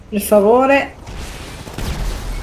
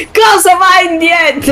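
frustrated shout; 8 semitones higher, 4 dB louder than usual. Real, not acted. Car stuck, shouting.

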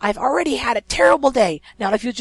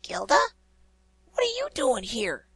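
surprised; 10 semitones higher than usual. Acted. Dash surprised to see Gilda.

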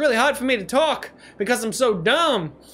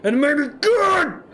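high excited shouting; hammed-up victory shout; mock triumphant yelling; 6 semitones higher than usual. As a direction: yell it like a proud caveman. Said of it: "And that's why I'm yelling!".

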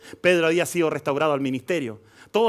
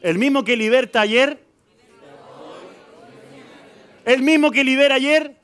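building preaching shout; 9 semitones higher, 6 dB louder than usual. Real, not acted.

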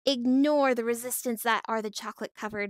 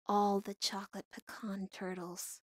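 normal talking voice

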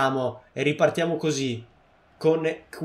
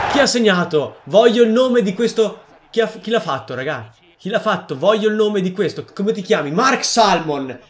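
excited; 4 semitones higher, 6 dB louder than usual. Real, not acted. Excited after goal.

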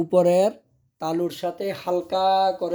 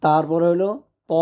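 normal talking voice

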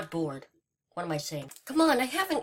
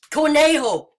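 louder, higher call of the name; 5 semitones higher, 7 dB louder than usual. Real, not acted. Calling out louder.

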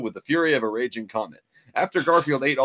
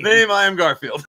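booming hammed-up Garfield voice, much higher and louder; 8 semitones higher, 6 dB louder than usual. Storyteller introducing Garfield to his co-host, hammed-up hero voice. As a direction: ham up the macho hero's greeting.

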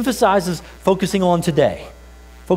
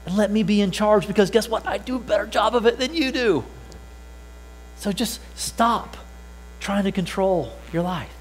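voicing Jesus; 5 semitones higher, 3 dB quieter than usual.

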